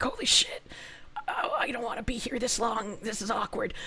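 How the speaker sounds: breathy awkward whisper. Retold or imitated. To herself at the crowded shelves, hushed whisper. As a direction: whisper it like no one should hear. Retold inner reaction at shelves.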